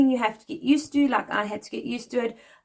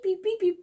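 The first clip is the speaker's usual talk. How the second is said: high, clipped mock robot beeping; robot beeps; 10 semitones higher than usual. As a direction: beep like a toy robot. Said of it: "they think you mean beep".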